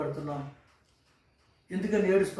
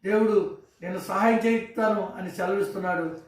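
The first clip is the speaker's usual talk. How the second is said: loud, higher, fervent preaching; impassioned pulpit preaching, louder and higher; preaching at full force; 3 semitones higher, 3 dB louder than usual. Acted. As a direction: build it into a rousing call to trust God. Sermon rises to full preaching.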